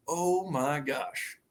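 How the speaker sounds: hushed, drawn-out disbelief; retold stunned awe. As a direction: let it sink in word by word, as a memory.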